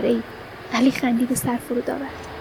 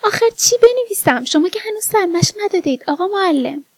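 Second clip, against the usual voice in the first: playful pupil's question, starting high and settling; lightly acted amused girl; amused protest; 6 semitones higher than usual. A narrator's reading. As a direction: tease the teacher with a high, lilting complaint. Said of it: "آرزو خندید".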